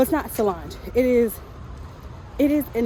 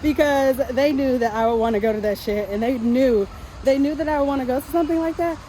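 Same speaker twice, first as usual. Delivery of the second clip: rising, thrilled and louder; excited; 4 semitones higher, 4 dB louder than usual. Real, not acted. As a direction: gush with disbelieving joy. Thrilled about surprise concert.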